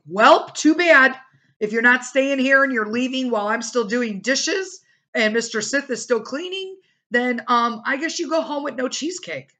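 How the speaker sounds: sassy imitation of her own telling-off; defiant sass. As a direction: tell them off with mock righteousness. Defiant quote of herself to guests.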